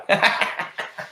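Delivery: quick breathy chuckle; short breathy laugh. Real, not acted. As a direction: laugh it off lightly. Laughs after nobody wins.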